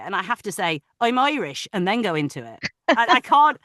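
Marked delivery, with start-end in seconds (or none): 1.0-1.7 higher-pitched Irish key phrase; put-on Irish lilt; Irish accent. Shown as a demonstration. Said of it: "have to go to the Irish".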